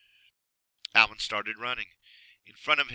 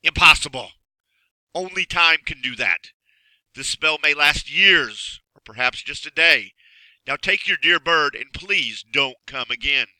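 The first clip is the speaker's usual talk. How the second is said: stern magician; 4 semitones higher, 9 dB louder than usual. Acted. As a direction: dismiss him with grim finality. Magician dismisses Alan.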